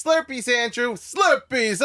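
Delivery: high-pitched imitation of the girlfriend; girlfriend's voice. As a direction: answer him in a high, eager mock voice. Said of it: "She goes".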